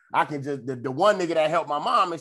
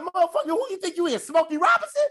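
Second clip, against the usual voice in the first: mock roast; faster than usual. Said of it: "I'm like".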